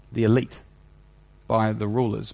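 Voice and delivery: ordinary delivery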